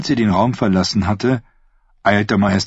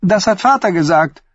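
a touch of biting mockery in the niece's higher voice; Thyene's voice; 13 semitones higher than usual. Thyene taunting her uncle the prince, mildly mocking. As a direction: needle your uncle with sweet sarcasm.

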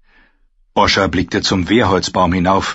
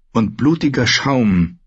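ordinary delivery

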